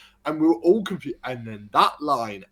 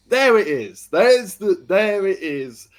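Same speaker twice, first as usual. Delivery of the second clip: excited realization; 7 semitones higher, 9 dB louder, slower than usual. Retold or imitated. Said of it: "And we're like".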